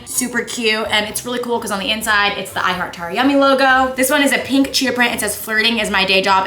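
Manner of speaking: bright, upbeat promo voice; ad read. Acted. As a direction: pitch the merch with energy. Hat promo plug.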